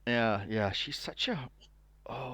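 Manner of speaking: ordinary talk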